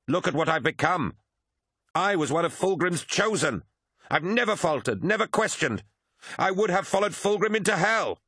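high-pitched, indignant outburst, climbing as it goes; petulant bitterness. A narrator's reading. Betrayed favourite refuses to believe his commander abandoned him, bitterly indignant. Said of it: "twisted in petulant bitterness".

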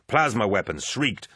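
ordinary delivery, nothing marked

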